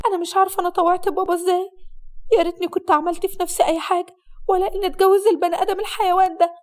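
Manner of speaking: high-pitched, upset bride venting; bitter bride. Acted. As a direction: complain bitterly to a close friend. Voices the bitter bride complaining to her friend. (عروسة مقهورة بتشتكي لصاحبتها)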